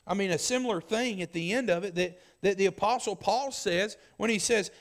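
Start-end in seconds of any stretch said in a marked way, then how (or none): none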